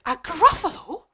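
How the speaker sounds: high-pitched owl question; storybook owl, high and baffled; puzzled owl. Acted. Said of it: "And the owl said".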